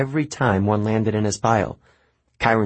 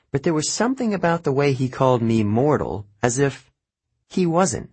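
ordinary delivery, nothing marked

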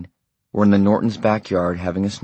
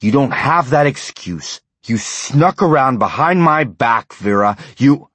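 louder, higher accusing tone; angry accusation; 6 semitones higher, 5 dB louder than usual. A narrator's reading. Husband accusing his wife of sneaking behind his back, angry. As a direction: accuse her to her face.

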